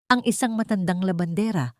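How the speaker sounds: plain reading voice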